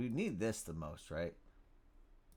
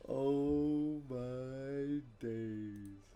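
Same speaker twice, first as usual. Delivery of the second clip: very slow, drawn-out awe; awestruck; much slower than usual. Real, not acted. Streamer stunned by his luck, awestruck and drawn out. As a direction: stretch each word out in stunned delight.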